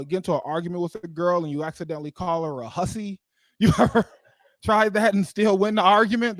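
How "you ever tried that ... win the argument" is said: incredulous. Real, not acted. Incredulous question to the crowd.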